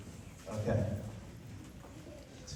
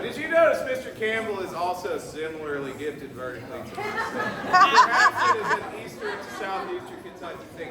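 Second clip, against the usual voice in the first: giggling through the words, pitched up; laughing; 8 semitones higher than usual. Real, not acted. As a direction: laugh while you tease about height. Teasing about short height, laughing.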